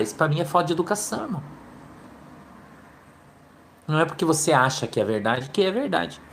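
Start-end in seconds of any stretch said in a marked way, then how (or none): none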